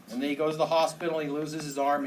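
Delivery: usual speaking voice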